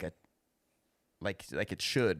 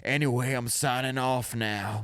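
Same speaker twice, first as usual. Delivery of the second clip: slow, oblivious radio sign-off; Rick; slower than usual. Acted. Host mocking Rick talking over the other man, slow sign-off. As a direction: drawl out a mock monologue ending. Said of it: "Rick's just like".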